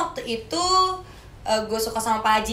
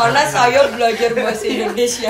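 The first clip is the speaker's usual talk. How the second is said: Hano's foreigner Indonesian; 6 dB louder than usual. Retold or imitated. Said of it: "kalau Hano yang ngomong enak deh".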